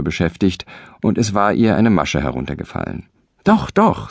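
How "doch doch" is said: much higher, insistent; eager insistence. Acted. Husband contradicts his wife, much higher and insistent. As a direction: jump in to contradict her. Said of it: "sagte der Mann".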